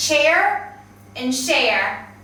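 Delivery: "chair and share" slowly, CH then SH; slow teacher's contrast of "chair" with "share"; CH versus SH contrast. Shown as a demonstration. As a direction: separate "chair" and "share" by their first sounds. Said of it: "focusing on sound contrasts".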